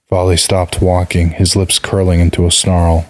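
ordinary delivery, nothing marked

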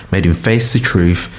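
ordinary delivery

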